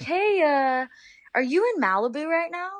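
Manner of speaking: high, casual imitation of the coach asking; imitating coach on phone. Coach BJ voiced calling her.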